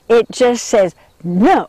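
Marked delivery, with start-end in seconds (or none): 1.2-1.7 louder, grumpy brush-off; grumpy dung beetle too busy to talk; tired grumpy no. Acted. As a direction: grunt it out like an overworked beetle. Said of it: "so tired and grumpy".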